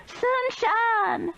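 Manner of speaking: very high desperate scream; screamed name. A narrator's reading. Meemaw screams for the missing woman. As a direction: cry out the name in fear. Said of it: "Meemaw screamed".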